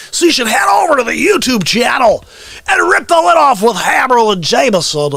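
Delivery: high, shouted show plug; hype announcer. Acted. Sending viewers to the YouTube channel, hype announcer shout. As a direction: belt it out like an arena hype man.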